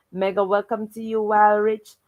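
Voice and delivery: ordinary talk, nothing marked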